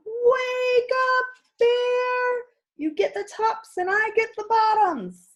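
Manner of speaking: high, loud wake-up call to Bear. Acted. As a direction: shout him awake from afar, high and bright. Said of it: "Hare called out".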